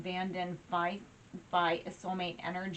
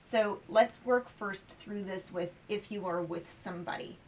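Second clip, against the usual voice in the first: ordinary delivery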